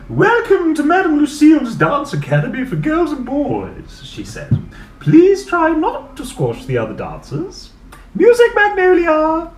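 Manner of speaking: a theatrical mistress calling the class to order; grand dance teacher. Acted. Teacher welcomes the class and calls for music, loud and grand.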